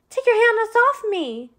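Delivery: high, loud and slow, fighting to break free; a young woman yells at her unseen attacker to let go, loud and high; frightened shout. Acted. Tella yells at the stranger grabbing her, high and slow. Seized and dragged into alley.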